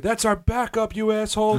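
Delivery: high, hammed-up heckling yell; a high, mock-angry heckle at the Toronto fan. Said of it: "I was like".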